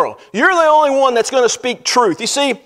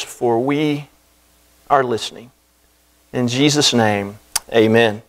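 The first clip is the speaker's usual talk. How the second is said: opening prayer; 7 semitones lower than usual. Acted. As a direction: pray humbly before the congregation, unhurried. Pastor leads opening prayer.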